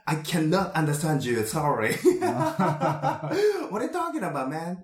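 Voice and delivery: hammy broken English small talk; fake English. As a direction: play a phony English-conversation teacher. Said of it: "エセ英語をちょっと喋り始めた".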